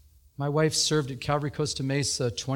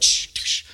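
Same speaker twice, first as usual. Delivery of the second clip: shushing; 5 dB louder than usual. Retold or imitated. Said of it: "she's like".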